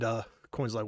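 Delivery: ordinary talk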